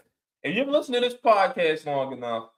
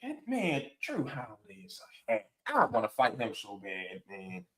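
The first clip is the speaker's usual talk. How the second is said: muttered grumble; 6 semitones lower, 10 dB quieter than usual. Retold or imitated. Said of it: "you're looking at it like".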